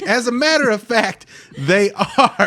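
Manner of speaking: mock sponsor pitch to the gunman; proud ad pitch. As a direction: boast like a pizza commercial. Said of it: "I'm like".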